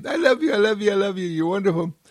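high, rapid doting voice; gushing adoration. Acted. You talk to a little hurt, high rapid adoration. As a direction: rattle off love in a high little voice. Loving words to soothe a hurt.

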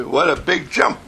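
usual speaking voice

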